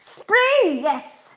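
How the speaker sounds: high, drawn-out excited cry; high-pitched eureka shout; sudden recall. Real, not acted. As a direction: blurt it out in triumph. Sudden recall of forgotten item.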